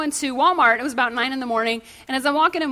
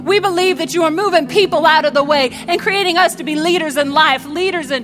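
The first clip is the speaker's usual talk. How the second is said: earnest prayer building in force; spoken prayer; 2 semitones higher, 4 dB louder than usual. Acted. Pastor praying over the congregation at the close, in earnest prayer. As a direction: pray over them, rising with faith.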